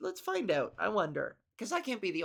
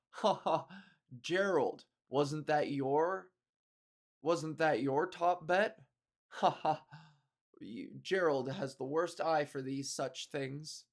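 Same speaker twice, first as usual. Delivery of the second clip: slow, lower, stilted rich-guy gloating; smug rich VIP; 4 semitones lower, slower than usual. Retold or imitated. Rich VIP mocking a friend over his lost bet, in a stilted gloat. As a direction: play a stiff, badly acted villain laughing at a bet. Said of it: "he'd be like".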